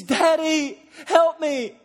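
high, loud crying plea; shouted cry for help; desperate plea. Retold or imitated. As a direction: beg like a helpless kid. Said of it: "When your marriage is on the rocks".

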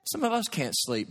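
ordinary talk

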